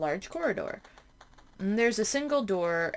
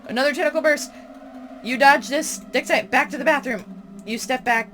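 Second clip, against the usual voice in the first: louder and higher, urgent; panicked narration; frantic reading; 4 semitones higher, 3 dB louder than usual. Acted. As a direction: read it like you're fleeing for your life. Frantic escape from tentacle.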